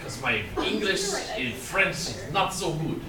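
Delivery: halting mock French accent. Teacher answering why he speaks English, mock French accent. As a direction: excuse yourself in put-on French English. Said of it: "'cause I'm French".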